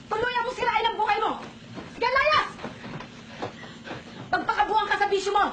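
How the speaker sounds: high, raging scolding yell; furious shouting. Acted. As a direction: scream her out of the house. Mother screaming at daughter leaving. (galit na sigaw)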